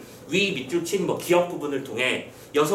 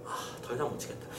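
hushed, whispered sigh of reluctance; muttering to oneself; 6 semitones lower, 15 dB quieter, faster than usual. Acted. Disguised wife alone with her thoughts, muttering softly. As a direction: say it under your breath. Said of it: "혼잣말로".

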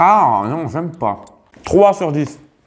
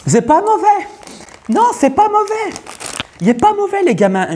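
high voice. Acted. Tasting, high put-on voice.

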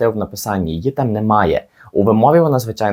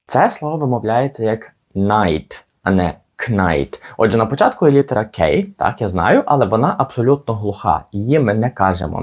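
normal talking voice

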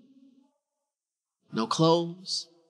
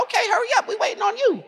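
put-on high, bright kid's voice, much higher; a small boy hurrying his mom along, voice pitched way up; impatient child; 13 semitones higher, faster than usual. Small boy hurrying his mom, high and impatient.

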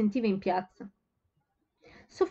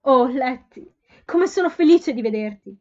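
higher, much louder, emotional outburst; moved to tears; 4 semitones higher, 10 dB louder than usual. A narrator's reading. Sophie overwhelmed at seeing her sister, emotional outburst. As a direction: gush with relief at seeing her. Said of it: "si commosse fino alle lacrime".

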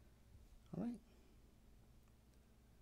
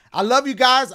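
cheery loud goodbye; 4 semitones higher, 10 dB louder than usual. Real, not acted. Loud stream outro farewell.

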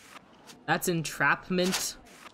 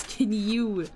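usual speaking voice

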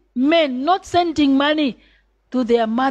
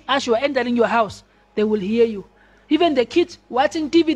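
ordinary talk

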